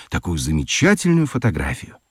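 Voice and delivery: ordinary talk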